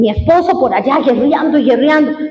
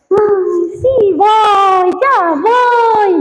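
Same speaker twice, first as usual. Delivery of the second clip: high, whiny stalling; lazy pretend promise; mock reluctant; 6 semitones higher, much slower than usual. Imita la pereza del creyente. (quejido perezoso, agudo y burlón)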